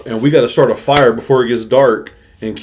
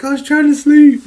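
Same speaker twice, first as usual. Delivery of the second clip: high mock child voice; 5 dB louder than usual. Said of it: "He was like".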